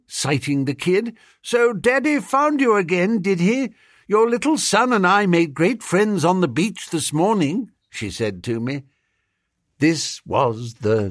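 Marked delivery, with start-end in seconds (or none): 1.4-7.7 high-pitched lady's voice; chatty and high, as to a child; Elizabeth, friendly chatter. A narrator's reading. Elizabeth to child and supposed father, high friendly voice.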